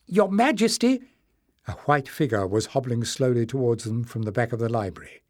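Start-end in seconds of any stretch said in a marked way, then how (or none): 0.1-1.0 put-on high, frail old man's call; a high old man's eager cry; old Joseph calling. Old librarian calls out.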